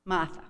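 "Martha" said Boston-style with no r, "Mahtha"; Boston pronunciation. Shown as a demonstration. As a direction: drop the r in "Martha". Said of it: "That's how they say Martha there".

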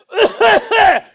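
loud, high, hacking cough burst; imitated morning cough. Imitating Boots coughing in the bathroom, loud hacking bursts. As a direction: mimic a sudden hacking retch of a cough. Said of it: "and I hear this".

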